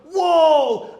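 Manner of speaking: loud, high shout of admiration; amazed wow. Acted. Synagogue crowd admiring Jesus's teaching, shouting wow. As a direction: belt out a delighted wow. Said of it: "Hace rato se admiraron, dijeron".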